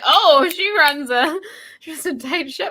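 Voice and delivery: high, mock-admiring sarcasm; sarcastic. Host mocking the cafeteria lady's strictness, sarcastic. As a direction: praise the strict teacher, tongue in cheek. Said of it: "I was like".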